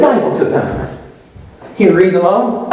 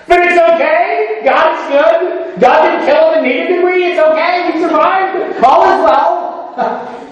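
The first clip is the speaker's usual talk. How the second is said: singsong reassurance; 8 semitones higher, slower than usual. Acted. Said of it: "soothing reassurance".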